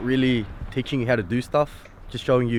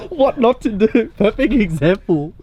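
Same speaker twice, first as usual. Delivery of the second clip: laughing; 10 semitones higher, 5 dB louder than usual. Real, not acted. Laughing at a bad jack-up.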